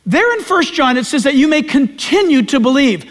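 normal talking voice

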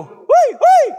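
high, loud whistle-like whee; shepherd's high whistle call. Retold or imitated. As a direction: call the sheep with a piercing whee. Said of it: "the guy will go".

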